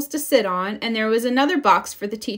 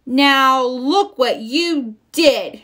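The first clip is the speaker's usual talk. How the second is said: slow, louder, angry shout; hammed-up angry cub; 4 semitones higher, 6 dB louder, much slower than usual. A narrator's reading. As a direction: blame her furiously. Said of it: "shouted Lizzie".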